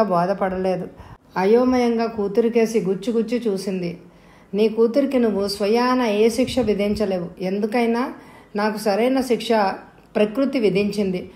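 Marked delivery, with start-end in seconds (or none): none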